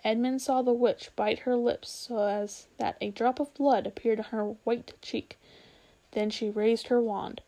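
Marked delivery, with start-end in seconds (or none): none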